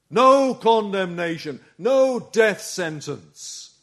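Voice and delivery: loud, exultant gospel preaching; impassioned preaching. Real, not acted. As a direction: proclaim it with full force, drawing out key words. Impassioned preaching at full force.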